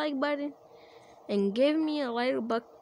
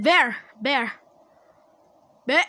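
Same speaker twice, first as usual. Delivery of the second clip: loud call-out, top of the lungs; urgent accusing shout; shouting the name; 2 semitones higher, 7 dB louder than usual. Real, not acted. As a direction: yell it to get everyone's attention. Calling out the suspect.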